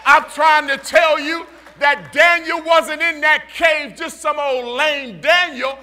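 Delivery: loud whooping preaching over the organ; rally the church to roar; loud rhythmic sermon climax; preaching at full force. Acted.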